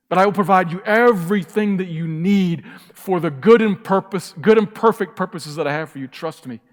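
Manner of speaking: loud, high, proclaiming promise easing down to a gentle close; voice of God. Acted. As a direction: speak as God reassuring his child. Voicing God's promise.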